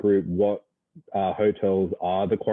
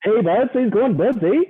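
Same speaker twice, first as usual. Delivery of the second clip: high, bright-up Canadian accent with eh; 12 semitones higher, 4 dB louder than usual. Shown as a demonstration. Aussie guest showing off his Canadian accent, hammed-up. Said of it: "that Canadian accent of yours".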